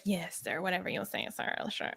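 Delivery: soft mock-obedient mutter; hushed, put-on compliance; outwardly obedient Glokta. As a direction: imitate a subordinate humoring his superior. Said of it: "But outward, he's like".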